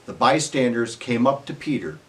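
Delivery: ordinary talk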